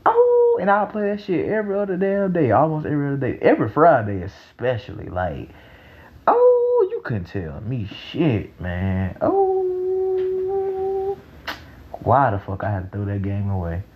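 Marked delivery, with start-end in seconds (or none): 0.1-0.6 squealed high oh; high excited oh. Real, not acted. Exclaiming over childhood game. 6.3-6.9 squealed high oh; high excited oh. Real, not acted. Exclaiming over childhood game. 9.2-11.2 squealed high oh; high excited oh. Real, not acted. Exclaiming over childhood game.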